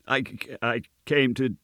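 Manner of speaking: high-pitched, halting and timid; frightened stammer. A narrator's reading. Terrified Gabriel answers the goblin, high stammer. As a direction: stammer nervously, breaking up the phrase. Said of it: "stammered Gabriel Grubb".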